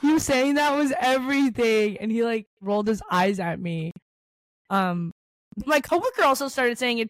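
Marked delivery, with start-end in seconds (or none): 0.0-2.0 gushing reaction. Retold or imitated. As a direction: gush it like a thrilled fan. Retold gushing reaction to brother.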